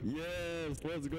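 high, drawn-out victory cheer; real gamer's celebration. Real, not acted. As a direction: cheer them on with the voice lifted. Cheering a turn in the game.

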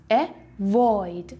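avoid slow, second syllable void held longer; drawn out uh-VOOID; stress on void. Shown as a demonstration. Teacher stretching avoid to show stress.

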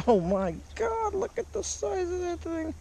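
very high amazed exclaim; excited. Real, not acted. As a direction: squeal in disbelief at its size. Marvels at big fish.

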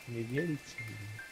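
usual speaking voice

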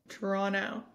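"Toronto" as tuh-RON-oh, no second T; local Toronto pronunciation. Shown as a demonstration. Co-host copying the host, "Toronto" without its second T. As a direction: repeat "Toronto" with the last T dropped. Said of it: "Oh, that was good".

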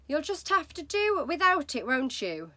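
wife's voice. Acted. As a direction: shrug him off. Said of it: "his wife told him".

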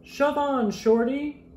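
pushy high shout; mock yell. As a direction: bus shoving a small truck aside. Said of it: "yelled a double-decker bus".

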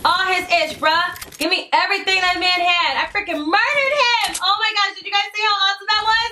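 high-pitched, breakneck excitement after a win; real, giddy victory rush; elated. Real, not acted. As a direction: burst out thrilled at the kill. Elated after winning a fight.

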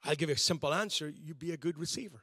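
ordinary talk, nothing marked

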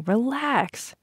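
high, easygoing brush-off; dismissive reassurance. A narrator's reading. Bobby brushing off Vanessa's worry.

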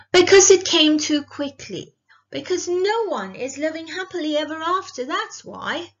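high, indignant outburst, loud at first; upset protest. A narrator's reading. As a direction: burst out arguing with the storyteller. Abilene argues with the storyteller.

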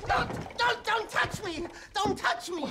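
high-pitched frantic cry; panicked. Acted. Accountant cries out not to be touched, panicked. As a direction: fend someone off hysterically.

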